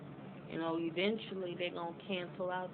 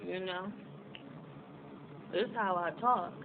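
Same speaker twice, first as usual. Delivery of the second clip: normal talking voice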